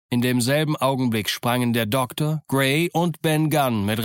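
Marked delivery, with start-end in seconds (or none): none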